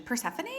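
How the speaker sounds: "Persephone" softly, rising like a question, with a laugh. Shown as a demonstration. Reader laughing at how she said the name, questioning. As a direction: echo "Persephone" as an amused question. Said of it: "when I say that like a question".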